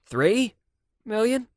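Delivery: high, rising question; checking she heard right; surprised question; disbelieving Siv. A narrator's reading.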